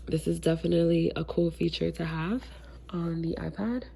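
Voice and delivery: ordinary talk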